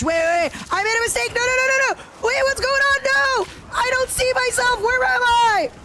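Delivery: high, loud panicked yelps; sudden panic, voice shooting up; panicking. Real, not acted. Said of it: "sorry for panicking".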